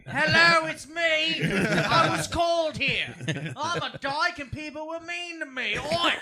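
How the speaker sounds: high, loud put-on Aussie lady voice; shrill mock Tasmanian woman; Hannah Gadsby impression. Impersonating Hannah Gadsby, accent.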